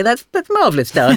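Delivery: a hint of Wigan in the praise; Wigan accent. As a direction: sketch a husband's Wigan accent, fondly. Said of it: "in his Wigan accent".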